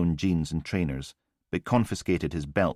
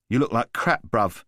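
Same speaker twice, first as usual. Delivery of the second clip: higher, taunting chav with a sneer; cocky street thug; 5 semitones higher, 4 dB louder than usual. A narrator's reading. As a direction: needle him like a smug geezer. Voice of Newman the thug.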